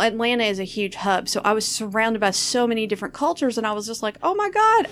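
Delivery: ordinary talk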